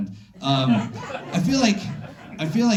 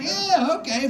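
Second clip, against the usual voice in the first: high, humoring agreement; indulgent high voice; 11 semitones higher than usual. Retold or imitated. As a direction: play along in a high voice. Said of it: "You know, like".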